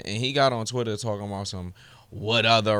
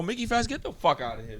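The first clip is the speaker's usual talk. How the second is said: angry dismissal; 5 semitones higher, 8 dB quieter than usual. Real, not acted.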